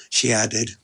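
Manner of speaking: ordinary delivery, nothing marked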